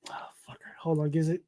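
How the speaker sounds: hushed whispered curse; whispering. Real, not acted. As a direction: whisper it under your breath. Website ad trouble.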